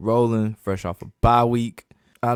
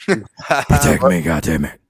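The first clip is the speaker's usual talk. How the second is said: lightly growled Batman-style command; a hint of Batman barking at linemen; 5 semitones lower, 4 dB louder, slower than usual. Teasing friend voices Brady as Batman, a hint of growl. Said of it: "He gotta talk like Batman".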